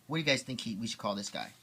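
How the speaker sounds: usual speaking voice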